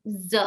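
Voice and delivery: Z held as a buzzing zzz; clear Z sound. Shown as a demonstration. Teacher isolates the Z sound for students. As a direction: buzz the Z. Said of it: "a Z sound".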